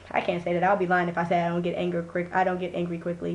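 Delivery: ordinary talk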